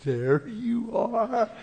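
drawn-out, high, teasing singsong; playful mirror greeting. Retold or imitated. Preacher greeting God in his mirror, playful high singsong. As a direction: coo it high and very slowly, like peekaboo. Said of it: "look in the mirror and say".

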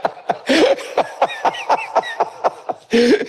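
long high-pitched laugh; real breathless giggling fit. Real, not acted. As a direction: crack up at your own story. Laughs at own story.

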